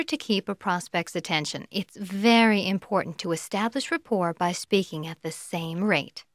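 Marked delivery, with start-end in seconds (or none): none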